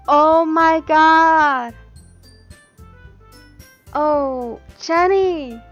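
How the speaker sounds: loud, drawn-out, high exclamation; shocked delight. Real, not acted. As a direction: cry out slowly in real amazement. Reacting to surprise donation.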